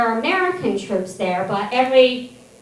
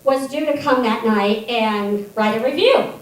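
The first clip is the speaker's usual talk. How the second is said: ordinary delivery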